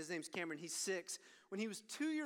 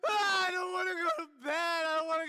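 put-on crying toddler whine; 8 semitones higher, 11 dB louder, faster than usual. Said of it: "he would cry and whine".

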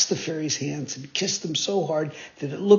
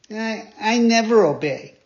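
put-on higher, louder boyish boast; cheeky puppet; 5 semitones higher, 5 dB louder than usual. Pinocchio boasting to the fairy, higher and louder. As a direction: brag like a naughty boy.

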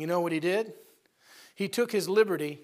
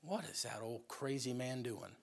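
slow, much softer grumble; muttered scoff; 6 semitones lower, 15 dB quieter than usual. Acted. Gehazi grumbling about Elisha refusing pay, slow muttered scoff. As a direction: grumble it under your breath, slowly. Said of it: "he goes".

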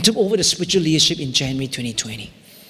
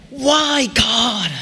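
raised, pained cry of why; upset cry; 6 semitones higher than usual. Retold or imitated. Pastor retelling angry prayer, crying out why. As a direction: call out to God in protest. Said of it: "my prayers went from".

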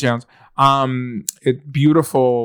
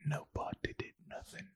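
whispering; 24 dB quieter than usual. Real, not acted. Whispered reply.

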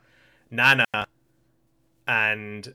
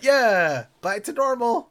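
mock cheerful girl; 10 semitones higher, 7 dB louder, slower than usual. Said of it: "And she's like".

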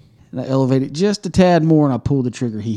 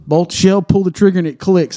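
normal talking voice

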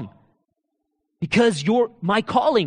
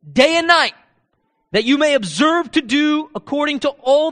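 louder, higher, slow preaching; preaching at full force; 6 semitones higher, 6 dB louder than usual. Acted. Preacher proclaiming Joshua's charge to the congregation, fervent. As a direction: thunder the verses out to the congregation.

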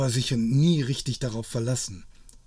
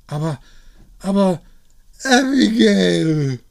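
hoch und laut gedehnt gerufen; hinterherrufen; 8 semitones higher, 11 dB louder than usual. Acted. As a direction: ihr verzweifelt nachrufen. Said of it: "rief er hinter ihr her". (ruft der Frau verzweifelt nach, hoch und laut)

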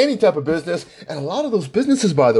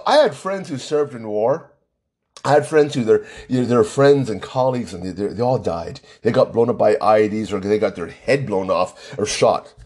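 normal talking voice

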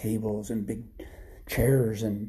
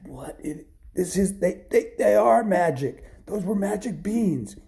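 hushed start building to high, loud wonder; stammer, then burst out in astonished excitement; stunned amazement; 8 semitones higher, 3 dB louder than usual. Acted. Jack amazed by the giant beanstalk.

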